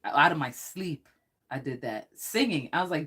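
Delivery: usual speaking voice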